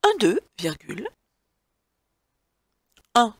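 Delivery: spaced dictation with long pauses; dictating. Shown as a demonstration. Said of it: "dictée read to the class".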